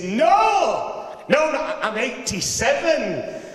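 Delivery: put-on a proud old boy putting him right; indignant old granddad. Granddad corrects the baker proudly.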